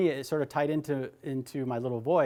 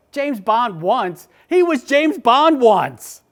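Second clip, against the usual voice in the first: incredulous; 8 semitones higher, 11 dB louder, much slower than usual. Retold or imitated. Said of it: "And I'm just like".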